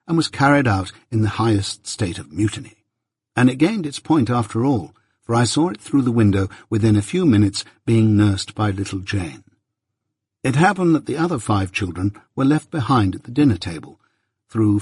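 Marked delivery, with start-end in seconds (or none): none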